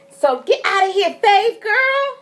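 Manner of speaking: high-pitched teasing send-off; playful shooing. Real, not acted. Vlogger shooing Faith away mid-story, playful scolding.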